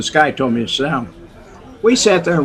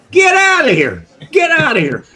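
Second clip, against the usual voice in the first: much higher, louder mock dismissal; imitating Sam throwing him out; 13 semitones higher, 3 dB louder than usual. Sam ordering Kenny out, hammed-up anger. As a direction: shoo him away like a fed-up boss.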